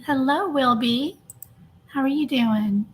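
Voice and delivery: normal talking voice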